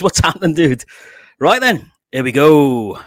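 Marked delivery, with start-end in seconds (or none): 1.4-3.0 excited announcement. Real, not acted. Host about to bring in guest.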